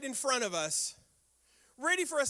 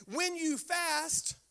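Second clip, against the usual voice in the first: slow quote; 6 semitones higher, much slower than usual. Shown as a demonstration. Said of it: "he reiterates once again".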